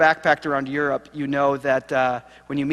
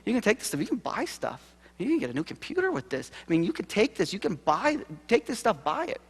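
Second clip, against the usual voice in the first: pleading Jacob; 6 semitones higher than usual. Retold or imitated.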